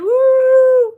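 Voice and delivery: high, loud celebratory woo; excited whoop. Acted. Host whooping loudly to thank a donor. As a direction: cheer for the donor at the top of your voice.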